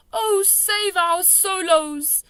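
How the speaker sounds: high, loud, very slow wail; anguished cry. A narrator's reading. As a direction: wail in distress. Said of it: "he cried".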